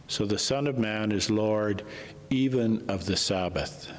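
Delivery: ordinary delivery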